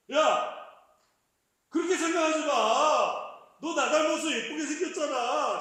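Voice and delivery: put-on half-joking furious outburst at the kid; angry shout. Father, fed up with consoling, yells at his sulking child in a loud mock-angry shout. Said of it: "나중에 좀 화가 나요".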